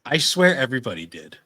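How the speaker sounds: ordinary talk, nothing marked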